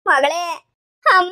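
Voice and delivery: a loud, pained call across the room; grief-struck calling out; anguished cry out. Acted. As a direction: cry out the name in distress. Mother and daughter reunite in jail.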